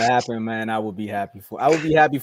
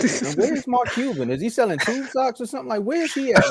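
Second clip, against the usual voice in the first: mocking question; 11 semitones higher than usual. Real, not acted. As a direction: poke fun at the absent owner. Mocking where the owner is.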